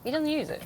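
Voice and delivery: "doesn't" pitched high with a falling stress; emphatic repeat. Real, not acted. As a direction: hammer home that he doesn't use it.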